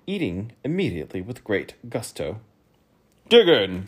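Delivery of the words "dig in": lightly voiced king, loud; king's voice. A narrator's reading. King loudly telling his guests to start eating. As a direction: call it out as the host king. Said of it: "said the king".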